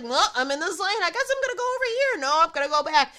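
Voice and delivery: high, fast, dithering driver voice; indecisive California driver. Retold or imitated. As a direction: flit between choices in a rush. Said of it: "They're like".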